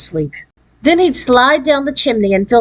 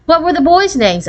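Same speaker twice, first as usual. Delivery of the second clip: higher, lively curious asking; wanting to know, pitched up; curious question; 8 semitones higher than usual. A narrator's reading. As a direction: ask it with eager curiosity. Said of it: "asked Deanie curiously".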